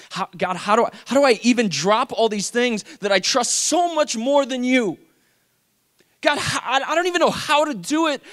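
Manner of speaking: high, forceful, exasperated pleading; desperate prayer. Retold or imitated. Man wrestling with God over trust, desperate. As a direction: cry out to God in frustration.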